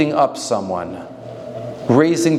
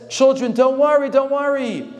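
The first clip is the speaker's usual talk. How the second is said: soothing mother; 10 semitones higher than usual. Retold or imitated. Mother calms her children.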